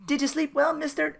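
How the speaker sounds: high-pitched imitation of polite small talk; innkeeper voice. Innkeeper greeting Phil as he rushes out, high voice.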